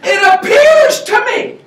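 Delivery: high, loud, slow mock-grand opening; added emphasis. Shown as a demonstration. Speaker hams up the line for the crowd, high and loud. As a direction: stretch it out for emphasis. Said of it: "this will add more emphasis".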